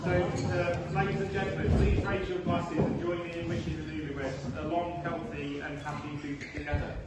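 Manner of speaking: wedding toast speech. Acted. Formal toast to guests.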